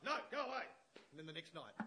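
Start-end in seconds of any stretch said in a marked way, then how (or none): 0.1-0.7 loud, dismissive judge brushing her off; annoyed judge. Retold or imitated. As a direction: wave her away as the fed-up judge. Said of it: "the judge is like".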